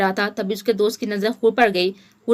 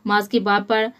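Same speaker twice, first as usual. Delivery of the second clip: ordinary delivery, nothing marked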